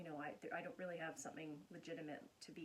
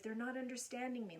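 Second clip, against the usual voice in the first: higher, louder mock frustration; emphatic inner voice; 6 semitones higher, 5 dB louder than usual. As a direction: act out not being understood. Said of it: "the more emphatic we get".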